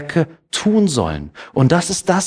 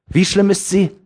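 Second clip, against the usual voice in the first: ordinary delivery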